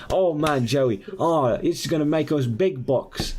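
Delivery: high, thrilled Aussie showman voice; excited gloating. Acted. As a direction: marvel greedily in a put-on Australian accent. Australian showman gloats over act.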